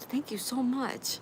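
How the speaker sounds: high, slow, softened thank-you; heartfelt gratitude, stretched out high; moved by a sweet compliment; touched thanks. Real, not acted. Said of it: "you're gonna make me cry".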